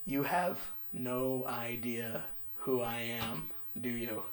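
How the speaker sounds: very soft, slow and low; hushed menace. Retold or imitated. Terrifying gym stranger to a twelve-year-old kid, hushed. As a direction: imitate an ominous stranger, barely voiced. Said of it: "And it was really silent".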